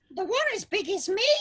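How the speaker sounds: put-on high squeaky Cockney kid; Cockney urchin. A narrator's reading. Tiny Cockney thug asking Scrooge, high squeaky Cockney. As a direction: croak it in thick Cockney. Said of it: "croaked the tiny thug with his thick Cockney tongue".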